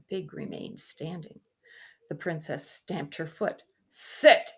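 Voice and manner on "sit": loud, forceful "Sit"; shouted. Acted. Princess shouting at a stubborn pig, loud. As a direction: bark the order at the pig. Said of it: "she shouted again".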